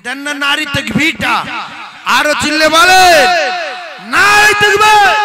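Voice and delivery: rallying preacher's shout. Real, not acted. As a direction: fire up the crowd at full volume. Rallies the crowd with takbir.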